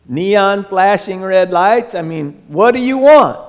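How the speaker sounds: sarcastic reply to Pharisees demanding a sign; lightly voiced, exasperated Jesus.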